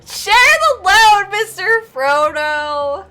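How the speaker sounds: loud, high, drawn-out heroic plea; impassioned movie quote. Acted. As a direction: cry it out slowly with hammed-up devotion. Film quote shouted in mock emotion.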